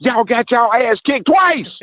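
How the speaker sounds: high-pitched, slow, gloating taunt; trash talk. Real, not acted. Caller taunting Southern fans over two losses, gloating. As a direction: rub it in their faces, savoring every word.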